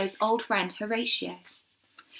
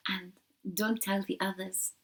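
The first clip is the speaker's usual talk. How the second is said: whisper; 7 dB quieter than usual. Acted. Secret aside to Shiloh.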